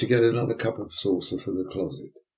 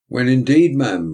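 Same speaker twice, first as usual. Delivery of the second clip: louder pompous agreement; pompous beadle; 4 semitones higher, 9 dB louder than usual. Acted. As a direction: boom it out like a self-important beadle.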